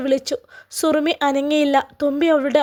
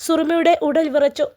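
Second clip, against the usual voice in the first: plain reading voice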